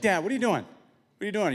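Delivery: put-on high, loud kids' questioning; imitated kids. Boys catch dad pacing outside, high mock-kid questioning. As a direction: call out like puzzled children to Dad. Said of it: "they're like".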